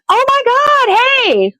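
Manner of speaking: high, put-on friendly hello; a friend spots you in Walmart, bright fake hello; fake friendly greeting. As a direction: act delighted to see him. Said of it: "I see him like".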